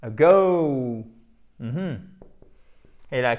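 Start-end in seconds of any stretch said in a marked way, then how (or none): 0.0-1.0 "a go" with weak schwa, loud long "go"; schwa then stress. Shown as a demonstration. Teacher showing the tonic accent, "a go" stressed on go. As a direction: reduce the "a" in "a go", stretch the gliding "go". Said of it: "prononcé comme un E atténué".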